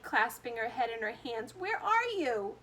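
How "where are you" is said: high, slow desperate call; frantic cry. A narrator's reading. Mother calling for her lost son, high and slow. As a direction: call out into the room. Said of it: "screamed Missus T V".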